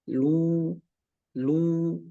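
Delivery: "lu" with a tight, rounded u; narrowed dhammah. Shown as a demonstration. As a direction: squeeze the u of "lu" narrow. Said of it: "disempitkan suara dhammah". ("lu" dengan dhammah disempitkan)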